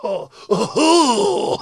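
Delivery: grunted mock Japanese exclamations, rising high; hammed-up Japanese accent, building to a whoop.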